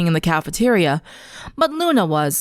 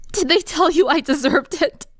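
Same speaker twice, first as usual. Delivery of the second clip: put-on broken girl asks the teacher; sobbing question; 8 semitones higher than usual. Sunset sobs to Luna, tearful and broken. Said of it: "Sunset sobbed".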